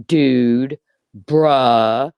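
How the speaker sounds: mock her as a teen thug; hammed-up teenage thug slang; mock thug boy. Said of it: "You sound like a seventeen-year-old thug boy".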